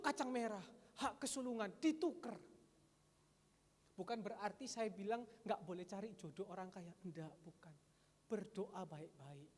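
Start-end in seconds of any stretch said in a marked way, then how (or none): none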